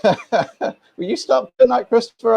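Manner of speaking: slow, high, laughing plea. Real, not acted. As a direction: tease him through a laugh. Teasing about name change.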